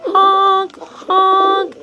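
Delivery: loud high honk honk; honking call. Acted. Reading to kids, loud honking bird calls.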